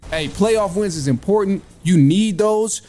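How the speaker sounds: normal talking voice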